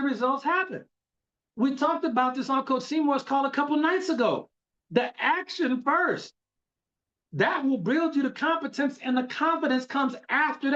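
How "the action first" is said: slow emphatic. Real, not acted. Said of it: "coach stressing the point".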